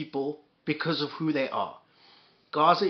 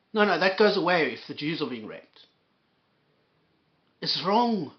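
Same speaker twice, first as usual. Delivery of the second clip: normal talking voice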